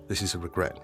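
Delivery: ordinary talk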